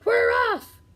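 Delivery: very high, loud cheer; crew shout. Acted. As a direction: shout it in triumph. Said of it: "we all shouted".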